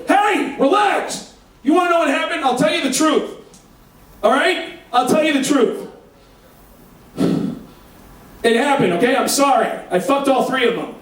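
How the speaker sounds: high shouted reassurance; calling out. Retold or imitated. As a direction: shout to calm an angry husband.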